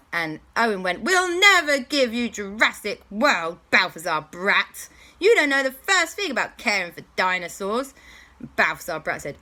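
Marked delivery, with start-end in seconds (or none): 1.0-7.9 louder, higher defiant hero. Acted. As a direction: stand up to the villain. Hero defies villain.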